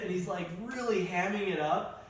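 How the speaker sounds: ordinary talk, nothing marked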